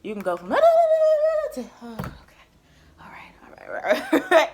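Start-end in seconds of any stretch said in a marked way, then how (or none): 0.5-1.5 sudden high loud laugh; loud high cackle. Real, not acted. Laughing at her own story. 3.0-4.5 whispered all right through laughter; laughing under breath. Real, not acted.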